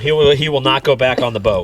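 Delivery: normal talking voice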